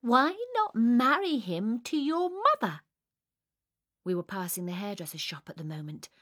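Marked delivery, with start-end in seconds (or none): 0.0-2.8 bright idea announced high and loud; pleased with oneself. A narrator's reading. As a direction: propose it triumphantly. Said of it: "very pleased with myself".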